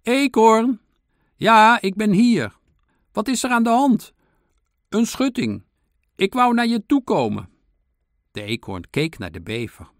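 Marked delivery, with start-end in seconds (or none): 0.0-0.8 high, loud distant hail; calling out. A narrator's reading. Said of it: "hoorde de eekhoorn de mier roepen". 1.3-7.5 high, loud shouted exchange; calling out. A narrator's reading. Squirrel and ant calling across fence.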